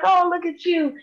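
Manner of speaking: put-on high cooing baby talk; cooing to animal. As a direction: coo high at the little animal. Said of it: "Be like".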